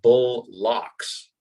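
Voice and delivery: "bull locks" as two slow, separate words; spelled-out pronunciation. Shown as a demonstration. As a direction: break it apart: bull, then locks. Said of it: "they spelled it out".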